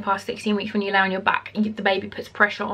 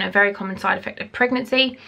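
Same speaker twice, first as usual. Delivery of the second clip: ordinary talk, nothing marked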